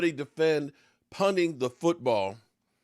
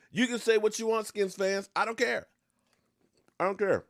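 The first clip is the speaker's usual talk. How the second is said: defiant rant; 7 semitones higher than usual. Real, not acted. Defiant rant at rival fans.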